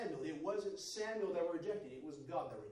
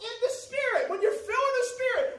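loud, high, fervent preaching; shouting the sermon up to a climax; full-force preaching; 14 semitones higher, 13 dB louder than usual. Real, not acted. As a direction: preach it out with rising fire.

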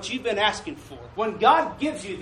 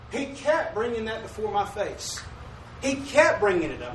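normal talking voice

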